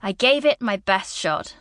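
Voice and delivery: ordinary delivery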